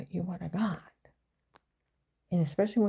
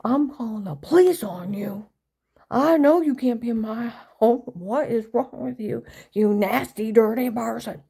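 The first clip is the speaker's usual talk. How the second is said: put-on loud, high, outraged old church lady; imitating mom yelling; 3 semitones higher, 9 dB louder than usual. Mother yelling at intruders, loud outraged church lady. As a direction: scold like a furious Southern Baptist mother. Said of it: "she would have went".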